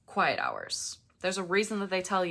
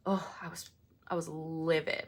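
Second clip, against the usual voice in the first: ordinary talk, nothing marked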